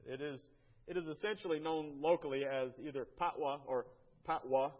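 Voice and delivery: ordinary talk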